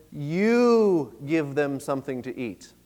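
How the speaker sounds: "You" drawn out long, the rest slow; slow, weighty line with a long, emphasized "You"; stress on YOU. Acted. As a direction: stretch "You" out, handing the job over. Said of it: "has an emphasis on the word "you".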